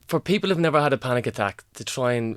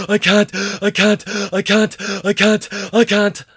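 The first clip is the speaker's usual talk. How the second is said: hyperventilating; 9 semitones higher, 9 dB louder, slower than usual. Acted. Panic attack climax, louder.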